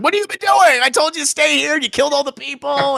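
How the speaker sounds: a furious dad demanding answers at full volume; angry father. Retold or imitated. Father confronting daughter Jinx.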